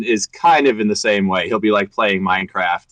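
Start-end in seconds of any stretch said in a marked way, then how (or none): none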